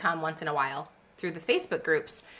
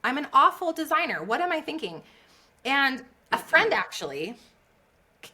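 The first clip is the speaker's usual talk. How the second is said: normal talking voice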